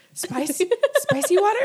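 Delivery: stammered question breaking into laughter; baffled, laughing. Retold or imitated. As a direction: laugh through the disbelief. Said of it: "I just kept saying".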